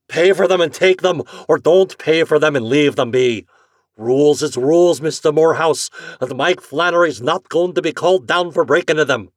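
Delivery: loud, stubborn Irish shouting; angry Irish agent. Acted. Flannery refusing the customer, loud and stubborn. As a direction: lay down the rules, loud. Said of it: "shouted Flannery".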